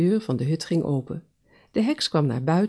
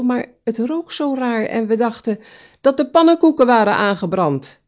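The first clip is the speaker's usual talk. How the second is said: high, louder eager call; 7 semitones higher, 7 dB louder than usual. Acted. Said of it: "riep de egel". (de egel roept het hoog en luid uit)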